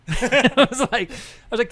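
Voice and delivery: real laughter breaking through the words; can't get the words out for laughing. Real, not acted. Laughing as he retells the secretary's reaction.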